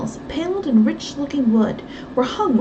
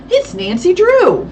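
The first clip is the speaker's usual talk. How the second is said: higher, louder, slow announcement; alarmed recognition; 8 semitones higher, 7 dB louder than usual. A narrator's reading. Miss Tyson naming the intruder, slow alarmed announcement. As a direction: spell out the bad news.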